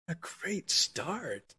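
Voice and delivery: slow, soft, disbelieving repeat; incredulous echo. Real, not acted. Plumber echoing the Democrats' phrase in slow disbelief. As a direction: drag it out, stunned by the phrase.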